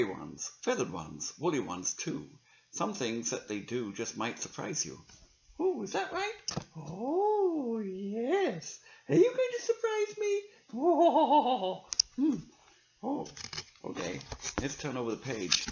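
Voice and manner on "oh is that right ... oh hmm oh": high squeaky puppet voice, much higher; a chirpy little puppet asking questions. Acted. Puppet friend chats with reader.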